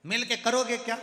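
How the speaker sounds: loud scoffing yell at the deaf grandfather; mock shout. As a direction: shout it up high in mockery. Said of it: "नाना चिल्ला कर बोला". (चिल्लाकर ताना मारते हुए)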